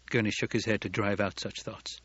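ordinary delivery, nothing marked

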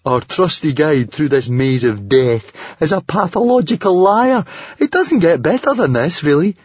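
high, loud sarcastic rant; exasperated irony, rising to a squeal. A narrator's reading. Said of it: "smiled Fishlegs sarcastically".

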